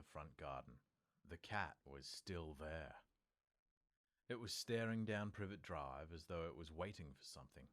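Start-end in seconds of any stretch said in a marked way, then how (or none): none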